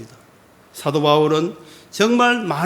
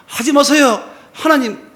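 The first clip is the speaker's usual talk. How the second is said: pleading cry; 8 semitones higher, 5 dB louder than usual. Acted. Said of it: "이런 표현이 아니라".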